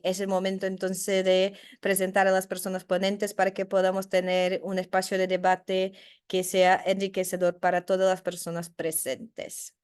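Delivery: normal talking voice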